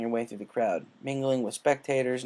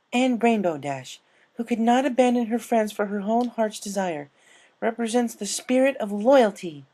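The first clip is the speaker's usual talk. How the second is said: higher, louder formal declaration; a touch of a solemn proclamation; solemn recalled speech; 10 semitones higher, 6 dB louder than usual. Retold or imitated. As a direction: proclaim it like a ceremony.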